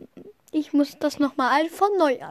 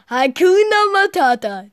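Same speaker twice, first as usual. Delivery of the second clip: loud call; 6 semitones higher, 8 dB louder than usual. Acted. Character quote, loud.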